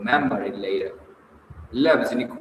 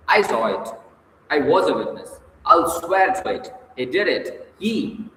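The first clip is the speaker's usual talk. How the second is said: higher, louder heated accusation; angry; 4 semitones higher, 3 dB louder than usual. Acted. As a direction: swear to it with fury. Furious testimony.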